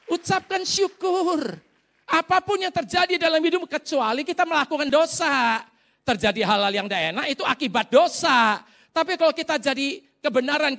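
high, loud, driving exhortation; full-force preaching. Real, not acted. Preacher urging thanks in all circumstances, high forceful preaching. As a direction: preach it hard, ringing out the refrain.

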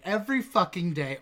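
ordinary talk, nothing marked

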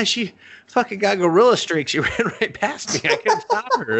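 put-on high, laughing, flustered girl voice; mock flustered DJ. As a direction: complain while cracking up. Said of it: "She's like".

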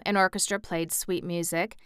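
ordinary delivery

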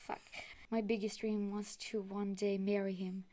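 ordinary talk

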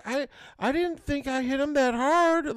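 high, drawn-out imitated child voice; daughter's excuse. Daughter admits hitting brother, high mock excuse. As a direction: play a kid defending herself.

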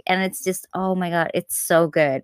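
ordinary talk, nothing marked

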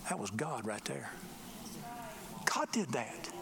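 quiet, low-voiced wonder; hushed awe. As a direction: drop to a hushed, low murmur of realization. Acting out awed realization.

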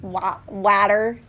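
"water" slowly, with a flat "wat" A as in cat; demonstrated pronunciation. Shown as a demonstration. Comparing with partner, slow "water" with A as in cat. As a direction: stretch "water" toward "watter".

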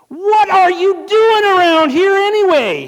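put-on older brother snapping at David; angry demand. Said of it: "he demanded".

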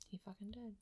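whispered deadpan reaction; muttered aside. Retold or imitated. Podcaster recalling her reaction to the movie fight, whispered aside. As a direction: say it under your breath, barely audible.

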